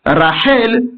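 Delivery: Rachel stressed on its final syllable; stress on last syllable. Shown as a demonstration. Teacher reads the accent sign, final-stressed Rachel. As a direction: bring out the second syllable of Rachel. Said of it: "syllabe qui est accentuée".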